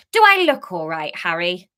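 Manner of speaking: loud pointed retort; loud mock-sarcastic line; sarcastic glare. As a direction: bite back at him, soaked. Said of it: "turned her head sharply to glare".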